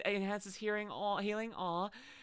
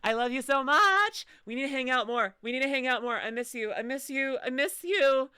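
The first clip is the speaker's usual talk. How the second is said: gushing affection; 6 semitones higher, 5 dB louder than usual. Real, not acted. Gushing at Mira.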